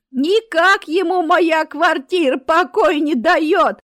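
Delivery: put-on high, emphatic old-lady grumbling; indignant old woman. A narrator's reading. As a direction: drag out the grievance like an aggrieved granny. Old woman complains about grandson.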